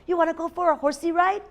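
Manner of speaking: high, quick invitation; lightly voiced horse offering a treat; friendly storybook horse. A narrator's reading. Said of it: "said a horse".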